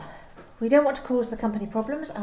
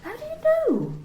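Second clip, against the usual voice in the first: alarmed surprise. Acted. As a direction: pitch jumps high in sudden alarm. Employee realises she was monitored.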